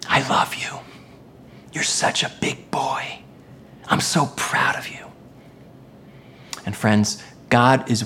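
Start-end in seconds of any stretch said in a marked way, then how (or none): 0.1-5.1 put-on soft whisper into a small child's ear; hushed, affectionate, intimate whisper; tender whisper. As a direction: whisper it lovingly, like a proud parent. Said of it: "and I whisper in his ear".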